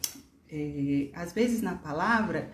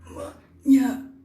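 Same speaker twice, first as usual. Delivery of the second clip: amanhã drawn out slowly and loud, higher; teacher exaggerating amanhã in breaths; slow loud demo; 6 semitones higher, 9 dB louder, much slower than usual. Shown as a demonstration. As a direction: sound out "amanhã" slowly for the class. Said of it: "vamos supor".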